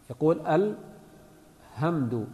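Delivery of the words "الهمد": "الحمد" with soft h, hamdu, lower pitch; ha mispronounced. Shown as a demonstration. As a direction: open breath h replacing ح in "الحمد". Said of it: "يبدل الحاء هاء".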